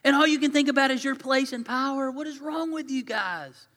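high, loud exasperated rant; mad outburst. Acted. Preacher as a fed-up Jesus scolding the disciples, mad and exasperated. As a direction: explode in disbelief, pitch way up. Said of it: "I would have gotten so mad".

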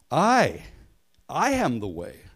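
emphatic "I," set apart from "I am the way"; stress on I. Shown as a demonstration. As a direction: hit the pronoun "I" hard before "I am the way". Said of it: "the verb means "I am," but then there's also a pronoun".

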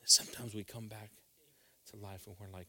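ordinary delivery

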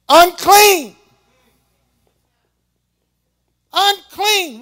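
loud, high, anguished cry of unclean; leper's cry. Acted. Preacher acting the leper crying unclean, loud. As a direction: cry out like an outcast leper warning the crowd. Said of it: "he has to say".

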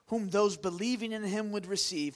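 usual speaking voice

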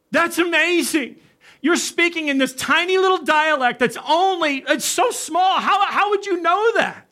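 high-pitched astonished wonder; baffled, excited disbelief; amazed. Retold or imitated. Amir amazed at hearing his dialect.

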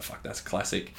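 breathy whispered curse under the breath; hushed unvoiced aside. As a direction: mutter it almost silently, all air. Retold reaction to an article.

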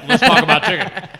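laughing while talking. Real, not acted. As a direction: laugh through the line. Laughing, changing the subject.

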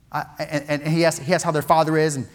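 usual speaking voice

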